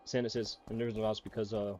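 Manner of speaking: low, subdued sick voice. Real, not acted. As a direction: speak low and soft, a bit under the weather. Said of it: "it gets a little deeper".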